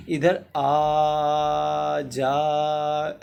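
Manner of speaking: very slow, loud call with the vowels of आ जा held long. Shown as a demonstration. Teacher demonstrating calling someone from afar, drawn out. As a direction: call across the yard, holding आ जा out. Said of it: "ऐसे कर रहे हो".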